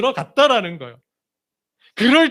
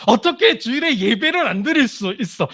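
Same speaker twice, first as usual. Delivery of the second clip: higher, reproachful mock quote; judgmental disbelief; 4 semitones higher than usual. Preacher imitating members judging an absent believer, reproachful. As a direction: scold like a shocked churchgoer. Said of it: "우린 먼저 이 생각이 들어요".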